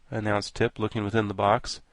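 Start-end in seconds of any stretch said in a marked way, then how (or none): none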